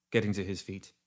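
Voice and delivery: plain reading voice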